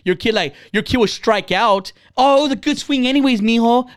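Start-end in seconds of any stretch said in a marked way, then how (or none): 2.2-3.9 higher-pitched, over-encouraging parent. Retold or imitated. Imitated parent to a kid who struck out, encouraging. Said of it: "you're not even yelling at him".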